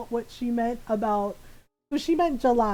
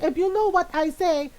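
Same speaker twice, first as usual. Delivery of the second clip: put-on exasperated Filipino-accented mom, louder and higher; mom's Filipino accent; 5 semitones higher, 3 dB louder than usual. Mom protesting she said so, in a loud Filipino accent. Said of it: "in my mom's voice".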